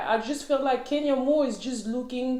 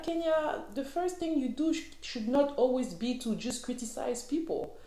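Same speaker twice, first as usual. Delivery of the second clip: higher, softer mock telling-off; imagined scolding; 4 semitones higher, 7 dB quieter than usual. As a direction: lecture Kenya in a raised, hushed tone. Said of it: "And I'm like".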